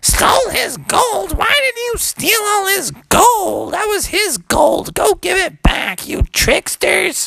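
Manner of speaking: very high, outraged shouting; scolding mommy frog. Acted. Mom learns of stolen gold, scolding shriek. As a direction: shriek a telling-off like an angry mom.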